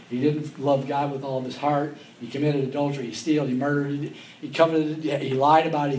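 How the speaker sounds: normal talking voice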